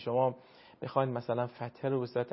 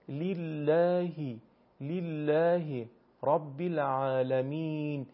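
slow Arabic recitation, kasra said as i; Arabic accent; much slower than usual. Shown as a demonstration. (با لهجهٔ عربی، شمرده)

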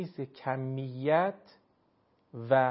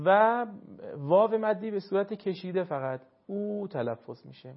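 usual speaking voice

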